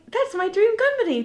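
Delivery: high, loud, giddy gush; re-enacted fan excitement; retold starstruck outburst. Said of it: "to the guy in his face".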